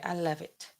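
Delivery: Trump. Acted. Streamer retrying the president's line, soft impression. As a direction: toss it off quietly as the politician. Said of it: "How would he say it?".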